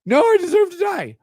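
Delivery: high pathetic mock whine; squealed mock despair. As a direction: ham up the self-pity. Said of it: "Texting me this".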